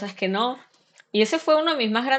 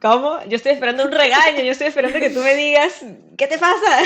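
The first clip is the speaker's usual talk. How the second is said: higher, louder, rushed disbelief; incredulous shock; 5 semitones higher, 6 dB louder than usual. Retold or imitated. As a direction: act stunned that mom isn't scolding. Imita su sorpresa incrédula. (sorpresa incrédula, aguda y fuerte)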